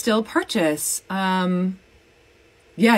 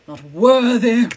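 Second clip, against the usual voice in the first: louder mock scorn; mock dismissal; 7 dB louder than usual. Scolding a dried-out marker, mock dismissive.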